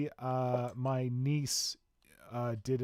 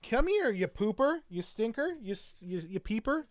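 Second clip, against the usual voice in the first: high, playful puppy talk; cooing to a puppy; 9 semitones higher than usual. Real, not acted. Calling the little puppy over, high playful cooing. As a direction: coax a pup over with fond name-calling.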